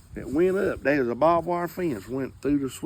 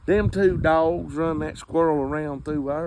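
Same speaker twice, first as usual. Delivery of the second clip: normal talking voice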